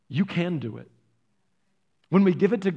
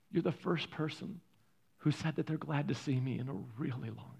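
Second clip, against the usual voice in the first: choked-up man; 3 semitones lower, 11 dB quieter than usual. Retold or imitated. Bawling man speaks to greeter.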